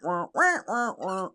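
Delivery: Peanuts teacher. Retold or imitated. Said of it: "the teacher on Peanuts".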